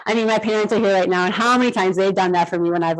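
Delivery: ordinary delivery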